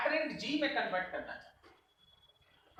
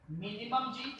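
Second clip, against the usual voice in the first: normal talking voice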